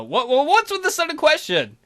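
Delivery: high, stammering panic; startled squeaky stutter; flustered surprise. Acted. Flustered character asked about girlfriend.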